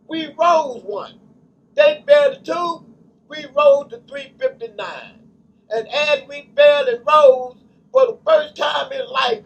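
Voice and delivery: loud, rising preacher's cadence; fervent pulpit delivery building in volume; preaching at full force. Acted. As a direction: preach it out to the congregation. Preaching the creation cycles.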